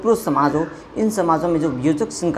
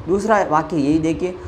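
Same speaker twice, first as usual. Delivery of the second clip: ordinary talk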